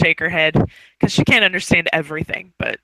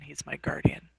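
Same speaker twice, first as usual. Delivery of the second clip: whispered aside; 3 semitones lower, 11 dB quieter than usual. Acted. Whispered end of character speech.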